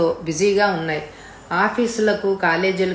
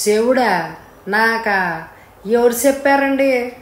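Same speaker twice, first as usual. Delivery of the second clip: higher-pitched, offhand questions; careless retort; 4 semitones higher, 4 dB louder, slower than usual. Acted. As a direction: toss the questions back, unbothered. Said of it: "నిర్లక్ష్యంగా అడిగాడు".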